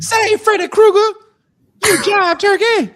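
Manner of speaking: very high, loud put-on seventies jive voice; seventies movie jive talk. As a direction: ham up an old movie hero's jive talk. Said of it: "talking like they were in the seventies".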